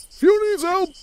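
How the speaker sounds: high, loud mock cry for help; urgent call. Acted call for help in game.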